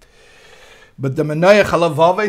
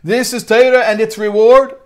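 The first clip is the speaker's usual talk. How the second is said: raised, pleading challenge of how this can be just; indignant protest; 7 semitones higher, 6 dB louder than usual. Acted. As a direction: protest the unfairness up high and loud. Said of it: "he says".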